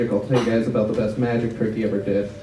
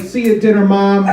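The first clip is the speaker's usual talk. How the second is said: higher, louder mock comeback yell; yelled back; 8 semitones higher, 4 dB louder than usual. Comic yelling back at the passing car, mock shout. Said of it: "so I yelled back".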